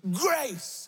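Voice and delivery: high, drawn-out call; mock shout. Man obeying God's order to shout, high mock shout. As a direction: call it out high, without real volume. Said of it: "I said shout".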